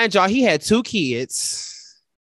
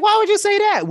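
much higher and louder, stunned; can't believe he said that; shocked question; 6 dB louder than usual. Retold or imitated. Retold shocked reply.

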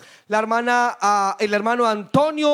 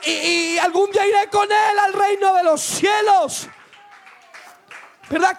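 raised, impassioned preacher's voice; preaching; 6 semitones higher than usual. Real, not acted. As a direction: preach it out, stretching the final words. Preaches at full force. (prédica encendida)

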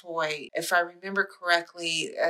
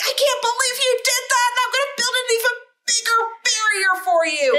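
high, loud outraged puppet voice; Grover voice; 5 dB louder than usual. Acted. Theater nerd voicing Grover for a friend, high outraged. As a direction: play an indignant Grover building a wall. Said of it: "he's go-, like".

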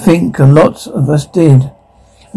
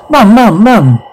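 put-on a frightened child crying out for her mother; panicked cry for Mum; desperate calling; 5 semitones higher, slower than usual. Child calls mother during attack.

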